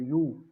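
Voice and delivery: यू held alone as the yoo sound; isolated sound demo. Shown as a demonstration. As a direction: show the yoo sound by itself. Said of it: "यू की sound आ रही है". (यू की आवाज़ अलग से दिखाना)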